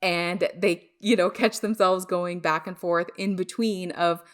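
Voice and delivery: usual speaking voice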